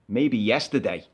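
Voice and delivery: low, slow driver's voice; gruff bus driver. Retold or imitated. Passenger retelling the driver refusing him, low and slow. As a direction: drop low and drag it out like a stern driver.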